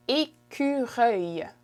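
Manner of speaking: écureuil drawn out slowly, syllable by syllable; French pronunciation. Shown as a demonstration. Teacher shows learners squirrel in French, slow careful écureuil. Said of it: "What's squirrel in French".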